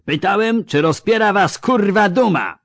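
high, loud bellowing roar at the ranks; furious roar. Acted. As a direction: bellow it in fury. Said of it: "ryknął". (wojewoda ryczy wściekle na pikinierów)

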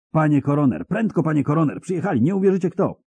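high, fast, urgent announcement; breathless urgency. Acted. Messenger bursting in to the coroner, urgent and excited. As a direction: rush in with news.

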